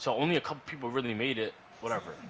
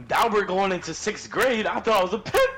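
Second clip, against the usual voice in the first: exuberant, stretched-out boast; loud comic hype; 8 semitones higher, 8 dB louder than usual. Real, not acted. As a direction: hype up the big reveal. Hyping up his sixth-grade self.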